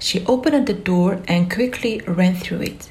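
usual speaking voice

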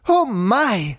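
high-pitched delighted gasp of words; delighted cry. A narrator's reading. Marie spotting a fancy pet shop window, delighted. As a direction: exclaim with high, girlish delight. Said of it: "she cried out delightedly".

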